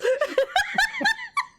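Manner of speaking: high laughing squeal. Real, not acted. Laughing at running joke.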